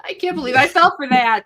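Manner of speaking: high, loud laughing line. Real, not acted. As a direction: laugh it out in disbelief. Laughing at falling for pun.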